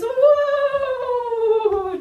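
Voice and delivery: high, loud drawn-out call to the horse; loud whoa call. Shown as a demonstration. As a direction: haul on the reins and call out. Pulling reins, calling to horse.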